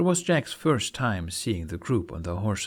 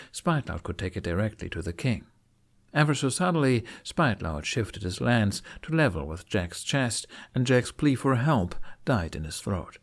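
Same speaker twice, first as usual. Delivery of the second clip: usual speaking voice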